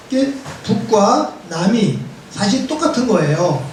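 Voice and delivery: normal talking voice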